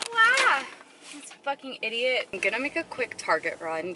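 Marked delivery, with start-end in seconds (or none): 0.1-0.6 high, loud startled wow; surprised wow. Real, not acted. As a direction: blurt out a wow at something unexpected. Sudden sight in the store.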